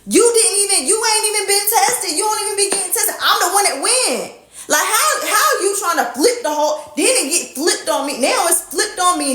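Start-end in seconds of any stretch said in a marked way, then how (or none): 0.1-6.4 high-pitched heated rant; retold outburst at the guy over getting tested; retold telling-off. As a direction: go off on him, voice jumping up. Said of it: "I said".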